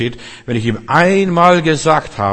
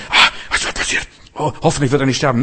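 breathless panicky whisper rushing into fast worry; anxious fretting; faster than usual. Retold or imitated. Preacher mimics a fearful parent at the hospital, panicky whisper. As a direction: mimic a frightened believer losing his nerve. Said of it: "wenn ich Angst habe".

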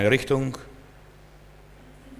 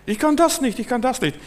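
reluctant Moses; 12 semitones higher than usual. Retold or imitated. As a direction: whine your excuses up to God. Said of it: "er gab Gott nicht recht".

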